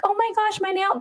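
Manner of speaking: high, whiny mock complaint; whiny girl voice. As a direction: imitate a girl whining about a nail. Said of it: "when girls be like".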